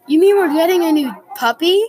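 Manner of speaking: high, loud and fast, the news just landing; thrilled disbelief at a new puppy; surprised. A narrator's reading. Kid asks mom, surprised and thrilled.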